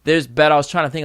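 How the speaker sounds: normal talking voice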